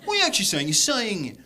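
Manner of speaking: high-pitched puzzled interruption; baffled English pal. Retold or imitated. English pal querying a Scottish phrase, baffled and high-pitched. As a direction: pull someone up, voice pitched high in disbelief. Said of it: "to one of my English pals".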